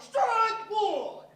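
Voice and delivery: loud, drawn-out umpire shout; umpire call. Retold or imitated. Umpire calling the strike, loud and drawn out. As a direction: bellow it like an umpire.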